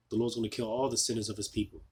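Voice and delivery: ordinary delivery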